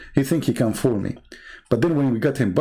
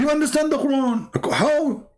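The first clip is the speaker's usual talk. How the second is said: high, disbelieving questions; can't believe what he's hearing; mocking disbelief pitched way up; incredulous; 12 semitones higher than usual. Real, not acted.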